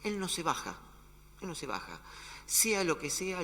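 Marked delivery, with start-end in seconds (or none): 1.4-2.0 much softer, lower echo; quiet. Acted. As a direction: repeat it softly as a quiet certainty. Repetición enfática. (repetición en voz baja)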